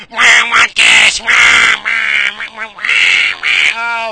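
loud, slow, high whining wah; mock crying kid. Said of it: "your little fucking dumb screechy voices".

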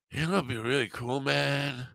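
mock a spaced-out pothead. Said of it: "he's acting like a fucking pothead".

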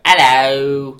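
loud mock British hello; Helena Bonham Carter. Host imitating the film's actress, mock British. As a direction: put on a broad British accent. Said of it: "Bonham Carter was doing her".

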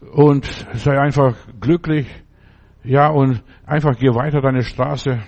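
fiery holiday sermon; full-force preaching. Acted.

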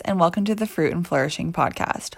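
loud, bright host opener, pumping up listeners; upbeat podcast intro. Acted. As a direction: hype them up like a show host.

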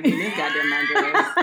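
laughing, half-shouted refusal. Real, not acted. Host refusing to give listeners the address, laughing.

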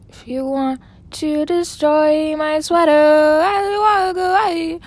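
hammed-up diva warning; mock warning. Mock threat over a sweater.

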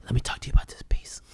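imitated hushed aside, quick whisper; mimicking a secretive murmur; confidential whisper. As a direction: whisper it fast like pulling someone aside. Imitating discreet person pulling artist aside.

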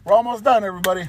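high, loud call-out; announcing. Real, not acted. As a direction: call it out to the audience. Announcing to listeners.

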